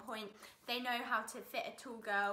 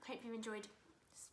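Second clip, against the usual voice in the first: whispering; 4 semitones lower, 10 dB quieter than usual. Real, not acted. Whispered sign-off.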